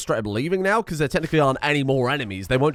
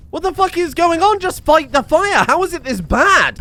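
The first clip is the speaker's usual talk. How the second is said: high, loud, incredulous shout; exasperated yelling; 14 semitones higher, 6 dB louder than usual. Real, not acted. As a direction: yell at a useless fire crew. Exasperated at colonists during fire.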